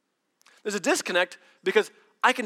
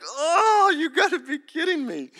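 much higher, louder whine; mock anguish; 12 semitones higher, 6 dB louder, slower than usual. Driver seeing more tickets, high mock whine. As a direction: whine in comic disbelief.

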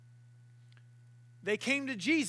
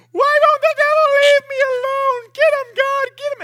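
loud, very high panicked plea; mock terror begging God for help; panicked whine; 17 dB louder than usual. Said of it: "A lot of us are going".

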